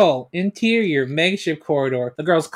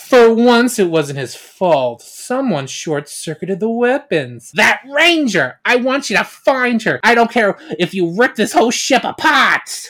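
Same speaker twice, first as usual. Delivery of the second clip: higher, louder commanding villain queen; villain queen rage; 6 semitones higher, 4 dB louder than usual. Acted. Queen orders Kegler to find the Ranger, angry and commanding.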